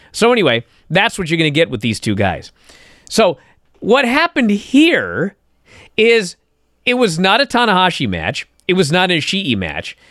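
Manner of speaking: ordinary delivery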